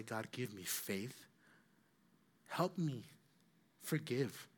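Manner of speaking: soft, slow plea to God; a quiet, humble plea for help; hushed prayer. Pretend. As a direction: pray it under your breath, slowly. Praying aloud in a remembered moment.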